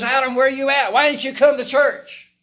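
high, loud cry across the garden; hollered call. Acted. God hollering out for Adam. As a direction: call out Adam's name like a shout. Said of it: "he hollers out".

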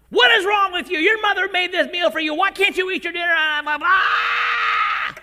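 loud, high, exasperated tirade; angry yelling. Retold or imitated. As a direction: blow up at the kid over dinner. Father blows up at dinner.

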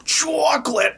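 high whispered shriek; wheezy cry of chocolate; SpongeBob chocolate lady. Retold or imitated. Echoing the SpongeBob quote.

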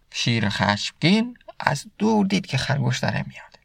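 ordinary talk

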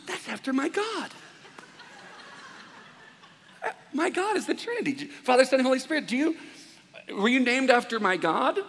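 high-pitched eager delight; excited discovery. Retold or imitated. Pastor retelling his thrilled reaction to the name Trinity, high and eager.

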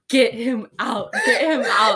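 laughing shout; urgent plea. Retold or imitated. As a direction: get him out, rising to a shout. Begging mom to remove ex.

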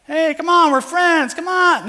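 high, loud wheedling "come on"; host coaxing the sleeper through the door, high and pleading; mock pleading. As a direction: beg a friend to open up, pitched high.

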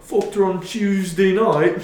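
put-on loud, high hammy British accent; mock British accent. Friends riffing on Bigfoot, in a loud high British accent. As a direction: belt it like a rowdy Brit.

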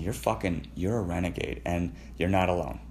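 ordinary talk, nothing marked

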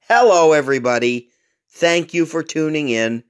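high announcer welcome; lightly voiced host intro; podcast intro. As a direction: open the show like a host.

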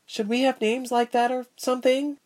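put-on high, hesitant girlish question; timid little girl's voice. A narrator's reading. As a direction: interject shyly, pitch way up.

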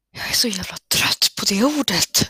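ordinary talk

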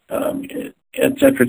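usual speaking voice

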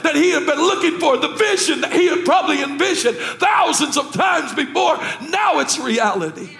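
high, loud, building sermon cry; full-force preaching. Acted. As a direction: preach it up to a shouted climax.

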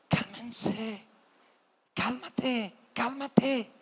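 calm them down gently; soothing Jesus voice. Acted. Jesus calming his terrified disciples in the boat, soft soothing voice.

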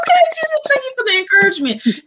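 very high, delighted voice fading into a whisper; hammed-up thanks to Jesus for a kind text; high amused prayer. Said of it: "And I'm like".

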